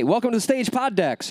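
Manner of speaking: lightly hammed-up emcee intro; mock stage announcer. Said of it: "and they introduced me".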